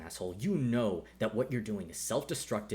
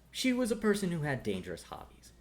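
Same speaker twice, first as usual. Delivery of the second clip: ordinary delivery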